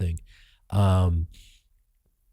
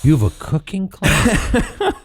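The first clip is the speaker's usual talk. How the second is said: much higher, slow disbelieving question; incredulous echo; 11 semitones higher, 4 dB louder, slower than usual. Retold or imitated. Actor repeating the director's excuse back, stunned.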